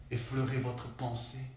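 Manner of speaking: soft, low, with long pauses; hushed grief. Acted. Colleague addressing the lost one, too late, hushed. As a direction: let each phrase fall quietly into silence. Mourning the last glance exchanged.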